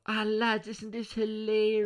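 high, loud, slow mocking mum; mimicking mother. Mother sharing a video to family WhatsApp, high loud mock gushing. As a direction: gush like an excited mum. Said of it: "your mother send it into your family WhatsApp going".

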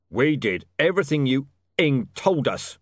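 loud furious shout. Acted. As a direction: bellow it in rage. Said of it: "shouted Mister Tulip".

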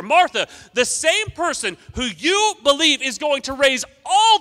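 loud, high, fervent preaching; full-force preaching. Acted. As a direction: preach it out with fire to the church.

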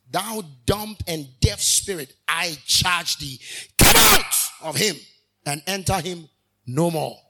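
loud, slow command bursting into a shout; stern rebuke. Acted. Jesus casting out a foul spirit, loud commanding rebuke. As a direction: order the spirit out with full authority. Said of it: "he rebuked the foul spirit".

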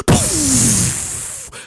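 long breath-blown whoosh; stretched "pew" sound effect; whoosh noise. Acted. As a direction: zoom off like a speedster. Imitates Flash speeding off.

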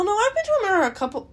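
ordinary delivery

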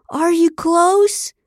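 high, very slow, hammed-up moaned question; loud mock moan. A narrator's reading. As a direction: moan it out for the cameras, stretched and rising. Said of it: "He moaned louder now and asked again".

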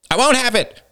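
sudden high-pitched outburst; defiant cry. A narrator's reading. Man defies the floating angels, crying out. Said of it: "cried another man suddenly, turning defiantly".

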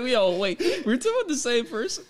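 high, laughing confused question; laughing disbelief. Retold or imitated. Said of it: "I was like".

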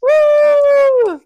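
high, loud whoop; delighted hoot; excited whoop. Real, not acted. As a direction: cheer out loud. Cheering at the picture.

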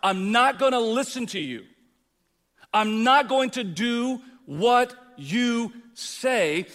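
loud, slow, stubborn defiance; rebellious retort. Retold or imitated. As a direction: refuse God flatly and slowly. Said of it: "It's another thing when it's the law of God".

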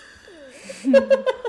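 high, loud laugh fit after choking. Real, not acted. Recovering from choking.

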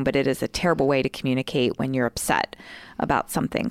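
ordinary delivery

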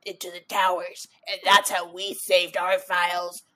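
lightly voiced grumpy old timer; a hint of a scolding elder; back in my day rant. Mock old person rant.

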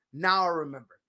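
ordinary talk

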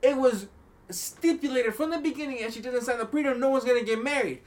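high, loud insistence; heated protest. Retold or imitated. Groom's side insisting on the prenup, in a high, loud protest. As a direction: lay down the law. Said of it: "They're like".

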